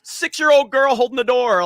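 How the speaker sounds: answering a friend's crude jab, exasperated; exasperated retort. Retold or imitated. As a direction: push back in disbelief.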